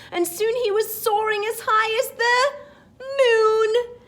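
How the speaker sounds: high, loud, stretched out to the moon; soaring excitement. Acted. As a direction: lift the voice up high with the balloon.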